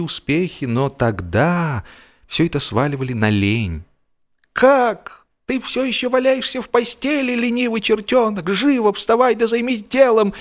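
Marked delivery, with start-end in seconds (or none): 4.5-10.3 high, scolding voice of a nagging relative; хмурое ворчание взрослого, высоко; scolding grown-up. Retold or imitated. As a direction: scold the lazy boy out of bed, much higher. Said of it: "говорили мне".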